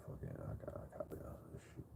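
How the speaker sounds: hushed, breathy whisper to himself; whispered giving up. Retold or imitated. Talking himself out of the fight in a whisper. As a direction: let it fall away under your breath.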